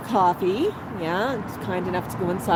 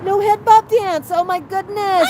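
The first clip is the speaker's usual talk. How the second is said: bursting out higher and louder in delight; excited exclaim; 10 semitones higher, 6 dB louder than usual. Real, not acted. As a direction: react with real glee at the bird. Excited reaction to the bird.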